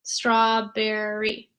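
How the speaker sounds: strawberry drawn out slowly by syllable. Shown as a demonstration. Teacher counting syllables. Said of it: "How many syllables".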